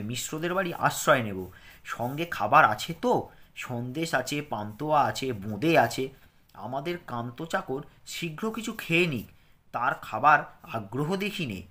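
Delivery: clear, careful lesson reading for pupils; reading aloud. Acted. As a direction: read it out slowly for children to follow. Reads the dialogue text.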